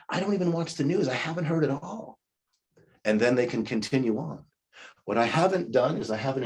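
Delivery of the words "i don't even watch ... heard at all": high, light and fast, brushing the topic off; airy and unbothered, a little higher; breezy deflection. Shown as a demonstration. Coach shows how to dodge a political jab, breezy and light. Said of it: "use a statement that works for you".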